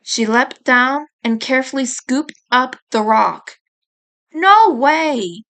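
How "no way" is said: louder, a flat no; firm refusal. A narrator's reading. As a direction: put your foot down. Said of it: "I refused".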